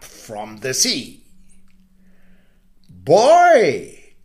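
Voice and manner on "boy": high, loud call of the sun; sun's cry. Acted. Sun calling to the boy, high and loud. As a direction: call him out sharply. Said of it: "the sun cried".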